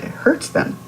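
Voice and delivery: ordinary delivery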